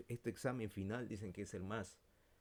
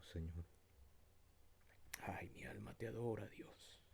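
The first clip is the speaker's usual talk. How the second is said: fervent prayer. Acted. Dirige oración final. (oración ferviente y susurrada)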